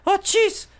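very high squeaky mock kid cry; imitating Francisco. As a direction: shriek it up high like the teasing kid. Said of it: "he talked more like this".

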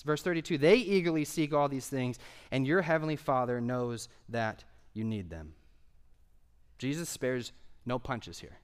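normal talking voice

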